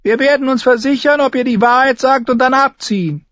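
high, thin, loud command; very high-pitched imperious knight; Sir Amory Lodge. A narrator's reading. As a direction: order them in a piercing, thin voice.

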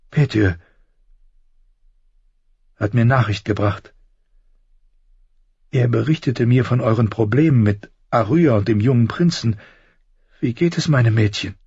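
gently voiced worried mother; Caitlin.

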